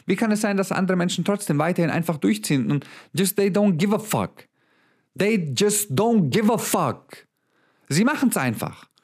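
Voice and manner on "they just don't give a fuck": higher and slow, every word hammered; slow emphatic repeat. Real, not acted. Coach hammering his point to viewers, slow and emphatic. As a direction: spell it out word by word.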